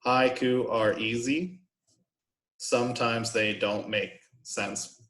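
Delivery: slow, each syllable separated; counted-out syllables. Shown as a demonstration. Teacher counting a haiku for the class, slow syllable-by-syllable delivery. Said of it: "We can count that out".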